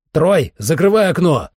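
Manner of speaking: loud, high urgent order; shout. A narrator's reading. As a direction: shout a warning to a companion. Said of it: "крикнул я".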